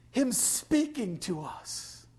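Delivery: usual speaking voice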